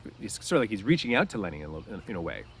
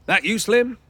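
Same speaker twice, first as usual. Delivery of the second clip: much higher, louder call toward the door; 13 semitones higher, 11 dB louder, slower than usual. Acted. Crooks calls through the door to Slim, loud and high. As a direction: call out to someone outside. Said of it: "he called".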